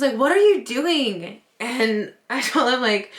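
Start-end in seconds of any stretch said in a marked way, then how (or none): 0.2-1.4 raised, louder, drawn-out question; mock-alarmed demand, stretched out; exasperated husband, imitated. Wife imitating her husband finding her up at night, exasperated.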